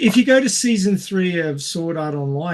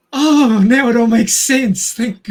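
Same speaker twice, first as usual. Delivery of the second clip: higher and louder, an overjoyed burst of relief; relieved exclamation; 8 semitones higher, 10 dB louder than usual. Pretend. To friends, imagining learning he is AI: loud, high relieved outburst. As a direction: cry out in mock relief at finally understanding. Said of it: "I'd go".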